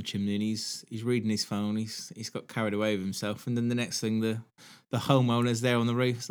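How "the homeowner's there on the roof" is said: usual speaking voice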